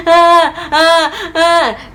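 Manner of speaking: imitated sobbing scream; crying scream. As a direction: wail in pain like a hurt kid. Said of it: "I was just screaming".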